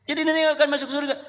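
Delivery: put-on high, fast, fretful old woman's voice; squeaky worried grandma; anxious grandmother. Old woman pleads with the Prophet.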